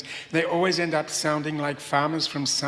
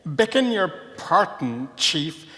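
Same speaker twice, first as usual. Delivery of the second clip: slow, louder bumbling apology; mortified village idiot; 2 semitones higher, 5 dB louder, slower than usual. Acted. Catarella apologises to Montalbano, slow bumbling voice. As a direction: stammer out a clumsy sorry to the chief. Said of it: "said a mortified Catarella".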